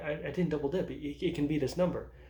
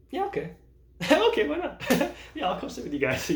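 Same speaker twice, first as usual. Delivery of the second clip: laughing; 11 semitones higher, 6 dB louder than usual. Retold or imitated. Retold laughing acceptance.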